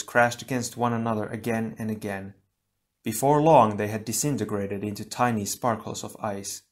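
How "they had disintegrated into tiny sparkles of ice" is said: ordinary delivery, nothing marked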